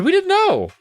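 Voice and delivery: high-pitched, apologetic protest; stammered apology. A narrator's reading. Girl apologises to the gnomes, high and flustered. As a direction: plead innocence in a high voice. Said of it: "I stammered".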